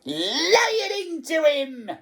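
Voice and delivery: very high, screeching egging-on; high, excited screech; shrieking old harridan. A narrator's reading. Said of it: "she was shrieking".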